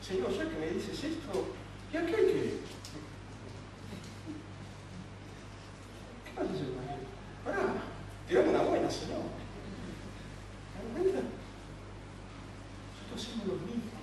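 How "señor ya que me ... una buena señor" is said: Peter whining. Pretend. Preacher voices Peter asking about John.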